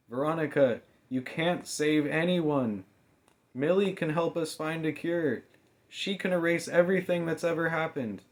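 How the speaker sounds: high, loud, urgent plea; desperate girl begging; pleading heroine. A narrator's reading. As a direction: try to talk her friend down. Mamie pleading with Veronica.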